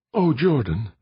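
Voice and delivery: high, gushing sigh of his name. A narrator's reading. Aditi gushes to Jordan, high and adoring. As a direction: pour it out adoringly. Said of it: "she gushed".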